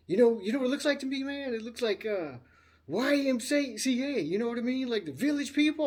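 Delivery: high, animated mocking of a friend; retold teasing. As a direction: act out a buddy's ribbing. Acting out friend's mocking of guitar.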